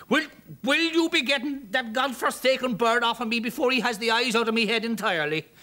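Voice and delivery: high, loud Irish brogue, frantic; frantic Irish shout. Acted. Stuck man yelling at the bird, frantic Irish. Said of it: "They heard a frantic shout".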